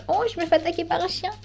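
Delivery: sudden jump high in pitch; startled. Real, not acted. Vlogger startled by a dog while filming, surprised. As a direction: caught off guard by a dog.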